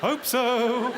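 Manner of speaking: high put-on passenger voice; unsure passenger. Fellow passenger asked if it's the right train, hammed-up unsure answer.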